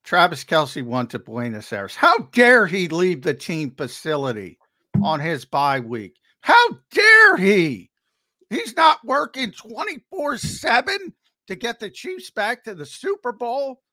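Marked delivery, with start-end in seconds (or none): 2.0-13.8 high-pitched mock indignation; sarcastic outrage. Co-host mocking a star's bye-week trip, sarcastic. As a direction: feign scandalized disbelief, voice climbing way up. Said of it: "people picked up my sarcasm there".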